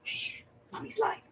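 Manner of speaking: real whisper to hush someone nearby; breathy shush, keep it off mic. Real, not acted. Hushing someone off camera.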